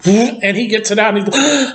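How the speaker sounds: ordinary talk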